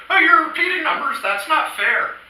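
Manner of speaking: high, loud whiny complaint; mock a whiny heckler; whiny jerk voice. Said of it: "somebody who had appeared to me as a jerk would go".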